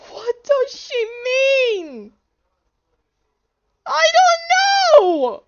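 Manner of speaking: high, loud panicked wail; scared freak-out. Real, not acted. Player baffled by what she means, panicked high wail. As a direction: stretch it high in rising panic. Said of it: "I am so scared right now".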